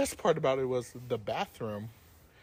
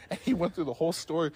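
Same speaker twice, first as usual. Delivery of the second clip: ordinary talk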